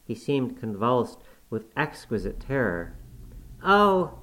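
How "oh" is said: high, loud sobbing cry. A narrator's reading. Terrified boy sobbing before his cousin, sobbing. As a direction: break into a sob. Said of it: "he sobbed".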